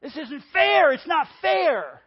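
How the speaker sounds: loud, high whiny protest, last word drawn out; childish tantrum. Acted. Preacher mimicking Jonah sulking at God, childish tantrum. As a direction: wail it like a kid stomping their feet. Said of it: "like stomping your feet and saying".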